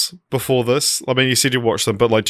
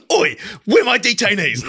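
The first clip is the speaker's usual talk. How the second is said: much higher, louder put-on guard voice; mock British guard yell; 10 semitones higher than usual. Camp mates shouting the meme at each other, high and loud. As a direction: crank the guard line way up. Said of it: "louder and louder and more intense".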